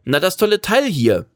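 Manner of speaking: put-on high, eager boy; boy's voice. A narrator's reading. Boy pointing out the camper to its driver, high and eager. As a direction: answer in a lifted, boyish voice.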